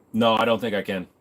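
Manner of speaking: ordinary talk, nothing marked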